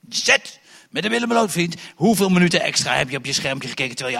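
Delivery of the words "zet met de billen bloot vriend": pushy demand. Acted. Preacher acts pushy church confessor.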